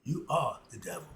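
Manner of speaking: Maury Povich voice. Retold or imitated. Host revealing the result to his guest, lightly mimicked talk-show voice. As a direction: lightly play the daytime host delivering the big reveal. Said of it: "In my Maury Povin's voice".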